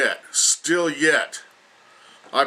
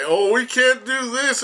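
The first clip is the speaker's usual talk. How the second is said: high, whining mock of complaining people; mocking whiny quote; 12 semitones higher than usual. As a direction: squeal it out like a helpless complainer, mocking them. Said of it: "When people say".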